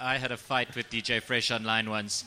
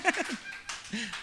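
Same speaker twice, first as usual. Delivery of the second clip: laughing; 11 semitones higher, 13 dB louder than usual. Real, not acted. Laughing after being roasted.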